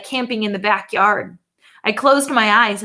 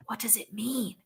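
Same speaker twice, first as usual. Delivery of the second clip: whisper; 14 dB quieter than usual. A narrator's reading. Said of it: "Dale whispered".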